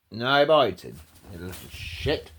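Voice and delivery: usual speaking voice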